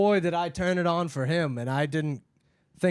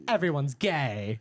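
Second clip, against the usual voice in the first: mock conservative; slower than usual. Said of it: "ironically doing conservative comedy".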